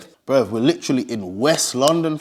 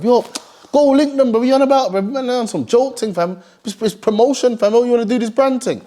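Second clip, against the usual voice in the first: high, pushy hype; hyped-up friend; 8 semitones higher than usual. Retold or imitated. A mate hyping him up to go meet them, high and pushy. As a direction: egg him on, pitched way up. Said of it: "They say link up on Friday".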